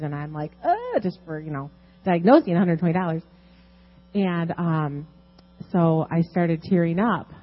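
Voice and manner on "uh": stunned. Retold or imitated. Stunned by a steep price.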